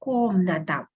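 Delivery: "комната" with a full O in the stressed first syllable. Shown as a demonstration. Teacher explaining stressed letter O, "комната" with full first O. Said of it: "so we pronounce it as O".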